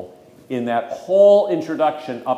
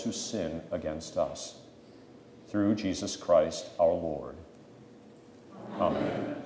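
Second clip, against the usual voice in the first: leading prayer; 3 semitones lower than usual. Real, not acted. Pastor prays the collect with the class, subdued praying. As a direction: pray it reverently, phrases sinking at their ends.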